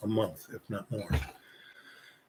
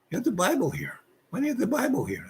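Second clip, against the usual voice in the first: high, softer voice for a baffled questioner; imitated puzzlement; 10 semitones higher, 7 dB quieter, faster than usual. Visitor questions him about having a Bible, puzzled. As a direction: retell his question in a high, incredulous voice. Retelling Falwell's surprised questioning.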